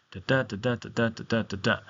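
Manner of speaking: "da DA" five times, unstressed then stressed; iambic beat. Shown as a demonstration. Teacher repeating the pattern for students, alternating weak and strong "da". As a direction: tap out the meter on "da", every second one strong. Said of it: "an unstressed syllable followed by a stressed syllable".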